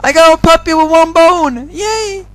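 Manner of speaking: high loud gleeful boast; excited. Real, not acted. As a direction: brag with bubbling glee. Showing off new puppy.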